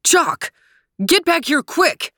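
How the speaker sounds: high, sharp urgent order; barked command to a friend. A narrator's reading. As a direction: call out a warning, pitched well up. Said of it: "barked Trooper".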